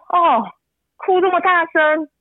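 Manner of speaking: mock a passerby's snide tone, drawn out slowly; snide remark. Mimicking a passing man mocking her son's crying, snide. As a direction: sneer at the crying, stretched slowly. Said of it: "用那种口气说".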